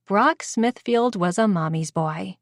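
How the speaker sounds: plain reading voice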